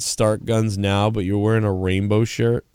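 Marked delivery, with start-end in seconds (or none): 0.0-1.1 "Start guns now" read slow and level; slow deadpan read of the sign. Shown as a demonstration. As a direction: spell out "Start guns now" flatly, word by word. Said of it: "imagine if you had a sign".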